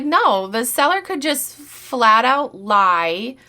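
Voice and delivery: normal talking voice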